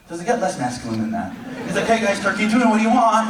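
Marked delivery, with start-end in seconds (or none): 1.8-3.3 put-on cheery lunch boy. David brings brothers lunch.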